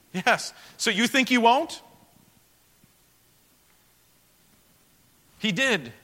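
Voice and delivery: full-force preaching. Acted. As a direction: drive the point home to the congregation.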